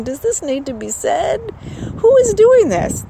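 exasperated, high-pitched questioning; incredulous. Retold or imitated. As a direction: pitch climbing as if utterly baffled. Acting out own baffled thoughts.

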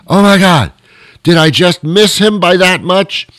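incredulous outburst, retold; dismayed disbelief. Acts out dismay at missing him.

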